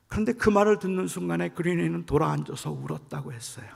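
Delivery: ordinary talk, nothing marked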